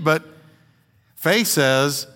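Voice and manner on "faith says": normal talking voice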